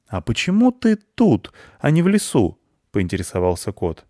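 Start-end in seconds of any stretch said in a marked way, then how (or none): 0.1-2.6 curious cat. Acted. Cat asks the boar.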